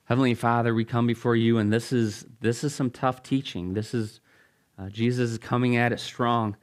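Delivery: low, measured pastoral prayer. Real, not acted. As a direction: pray aloud for the congregation, unhurried. Leads the congregation in prayer.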